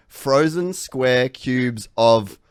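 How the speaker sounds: mock-patient overarticulating; exaggerated slow enunciation. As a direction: drag every word out, the final water punched loud. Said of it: "and I was like".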